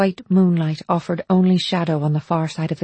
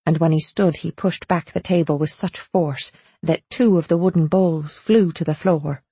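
usual speaking voice

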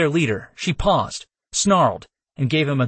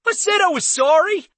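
high, quivering shout. Acted. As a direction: shout it with a shaking voice. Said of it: "he shouted, his voice quivering".

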